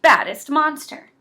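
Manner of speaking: usual speaking voice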